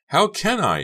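very high, loud protest; shrill cry. A narrator's reading. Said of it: "she cried shrilly".

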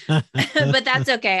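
loud, slow words through a laugh; real loud laugh on the words. Real, not acted. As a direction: laugh while shrugging it off.

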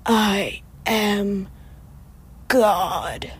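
a slow, dramatic reveal with a long pause before God. Acted. Telling her friend the scary story, a lightly voiced eerie reveal. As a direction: lean in and let it hang. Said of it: "there's a long pause, getting all dramatic".

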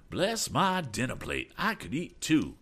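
hammed-up hungry exclamation; eager cry. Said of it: "cried Mister Damon".

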